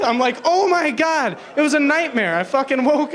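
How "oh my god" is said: higher, drawn-out appalled cry; mock horror. Father facing his talking newborn in a nightmare, mock horror. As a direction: recoil in exaggerated dread. Horrified reaction to nightmare baby.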